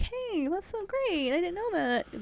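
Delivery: high, drawn-out put-on enthusiasm; hammed-up friendly amazement; mock delighted interest. Voicing strangers' reaction to his origin.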